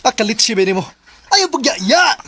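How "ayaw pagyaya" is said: shouted hurry-up; calling out. Acted. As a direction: bodyguard yelling to leave. Calling everyone to go.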